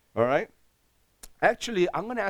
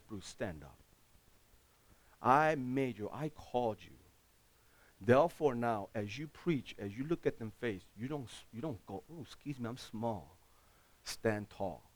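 quiet voice of God; 4 semitones lower, 6 dB quieter than usual. Retold or imitated. Voicing God's quiet command.

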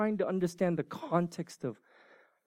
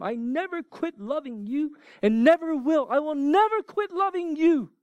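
higher, louder heartfelt promise of never-ending love; God's promise; 10 semitones higher, 4 dB louder than usual. Acted. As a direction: declare it with passion. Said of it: "God told them".

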